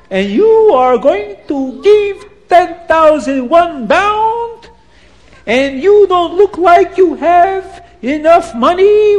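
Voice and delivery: put-on very high, slow accented outrage; angry Abdallah. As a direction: rage at the poor family's joke. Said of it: "he gets angry and he says".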